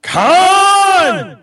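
long loud Khan scream; Khan yell. Pretend. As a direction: bellow the name, high and stretched. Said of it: "Okay, how about this?".

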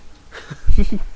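sudden burst of laughter; laughing. Real, not acted. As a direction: crack up out loud. Laughs at pink Toon Link.

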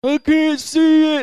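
put-on high, slow, whiny kid. Kid complaining in water.

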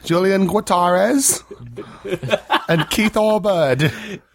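a hammed-up British host intro in a mock British accent; put-on British accent. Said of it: "before I lose how to do this accent".

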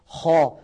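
خا with the air pressed up to the palate; heavy kha. Shown as a demonstration. Teacher has viewers try the letter, heavy خا. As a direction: say خا full and dark. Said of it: "هواء الحرف يتجه إلى قبة".

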